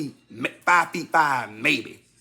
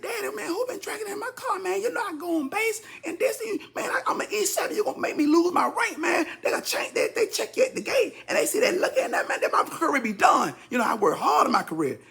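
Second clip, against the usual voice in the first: high, strained pleading rant; pleading with dad. Retold or imitated. Son confronts dad about drinking in his car, high pleading. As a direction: plead up high, close to tears. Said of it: "I said".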